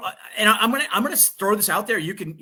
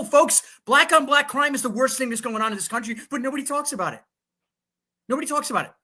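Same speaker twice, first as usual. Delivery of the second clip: heated, higher and louder rant; fired up; 6 semitones higher, 3 dB louder than usual. Real, not acted. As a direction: hammer the point home, all fired up. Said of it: "I'm fired up today".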